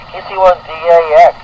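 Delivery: ordinary talk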